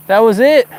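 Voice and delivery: the fish just got away; dismayed cry. Real, not acted. Fish lost.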